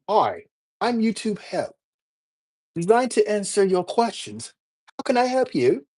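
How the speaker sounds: high-pitched put-on British help-desk voice; British accent. YouTube Help greeting Rob, British accent. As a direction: greet them in an amateur, lilting British accent. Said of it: "do this in my British accent".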